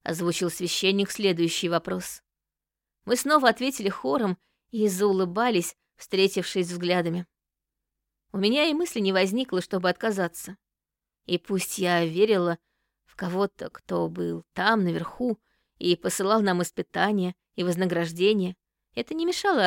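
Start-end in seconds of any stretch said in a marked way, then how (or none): none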